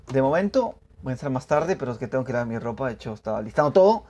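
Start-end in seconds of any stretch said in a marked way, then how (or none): none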